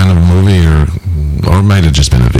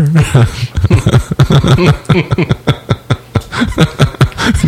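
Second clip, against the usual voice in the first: laughing; 8 semitones higher than usual. Real, not acted. Laughing at the joke.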